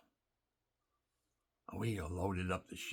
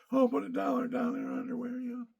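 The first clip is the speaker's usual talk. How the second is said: rowdy buddy; 7 dB louder than usual. Retold or imitated. Buddies egging on at bar.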